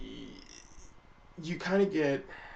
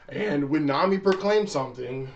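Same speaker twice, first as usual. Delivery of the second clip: ordinary talk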